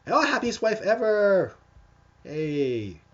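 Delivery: high, loud, drawn-out cheer of greeting; excited shout-out. Real, not acted. Host greets a donor in chat, excited shout-out. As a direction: call out big and high, stretching the words. Calling out to a viewer.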